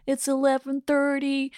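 slow, flat robot voice. Retold or imitated. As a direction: imitate a talking clock announcement. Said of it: "it's this robot voice that goes".

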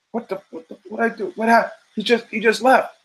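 high, confused stammer of the one left behind; baffled. Pretend. Date left alone at the table, baffled and high. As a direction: sputter in high disbelief. Imagined date reacting to walkout.

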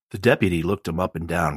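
usual speaking voice

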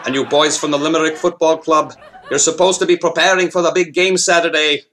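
put-on Irish cop accent; old-time Irish beat cop, Irish accent; 1930s beat cop. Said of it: "it probably goes a little something like this".

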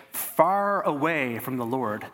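ordinary delivery

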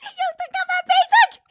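high, laughing hammed-up indignation; mock offended bride. Bride mocking the camera proposal, high and laughing. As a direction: play the insulted fiancée, giggling.

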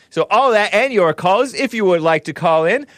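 loud, high announcer build to a stretched shout; hyped show kickoff. Real, not acted. Host kicking off the show for callers, hyped and loud. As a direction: launch it big, drawing out the final words.

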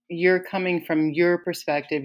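ordinary talk

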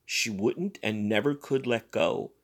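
ordinary delivery, nothing marked

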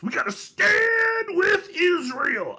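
very high, drawn-out shouted slogan; mock pastor's cry. Host mimicking a Baptist pastor's first words, hammed-up shout. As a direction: wail it slowly, stretching STAND and ISRAEL.

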